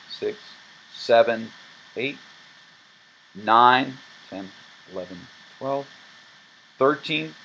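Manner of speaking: teacher counting with marked emphasis; emphasized counting. Shown as a demonstration. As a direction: stress the clapped counts, soften the dead sixteenths. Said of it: "use emphasis in my voice to show you".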